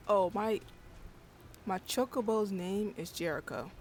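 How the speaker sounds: ordinary talk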